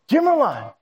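high mock call of Jim Rowan across the room; a woman spotting an old friend calls Jim Rowan; calling out across restaurant. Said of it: "I hear this woman yell at me".

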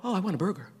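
dropped-volume mock musing; craving aside. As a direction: imitate a quiet sudden craving. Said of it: "and you go".

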